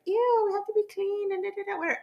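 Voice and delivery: high, prissy mock-disgusted voice; mock fussy Virgo. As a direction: squeal it out like a neat freak. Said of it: "because we're very like".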